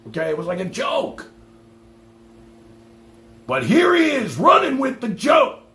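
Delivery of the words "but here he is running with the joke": loud, high outburst; worked-up shout. Real, not acted. Streamer venting at a rival, shouting high and loud. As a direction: bellow it in exasperation. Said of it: "I'm just passionate".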